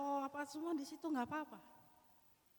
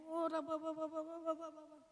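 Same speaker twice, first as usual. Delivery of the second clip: tongues prayer; 5 semitones higher than usual. Shown as a demonstration. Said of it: "jangan dilihatin efeknya".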